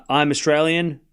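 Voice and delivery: ordinary talk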